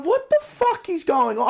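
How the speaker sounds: high-pitched exasperated outburst; flipping out. Retold or imitated. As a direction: lose it at the nurse. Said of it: "I just flipped it".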